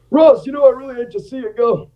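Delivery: lightly imitating a raspy disappointed colonel. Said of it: "He talked, he talked really raspy".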